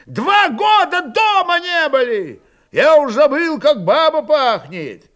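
high, loud homesick protest; crowd yelling. A narrator's reading. Cossacks clamour that they miss home, yelling. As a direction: shout complaints out over the crowd.

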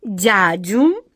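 regal, drawn-out word rising at the end; a stately, imperious opening; majestic queen. A narrator's reading. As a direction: begin grandly like a queen. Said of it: "величественно начала королева".

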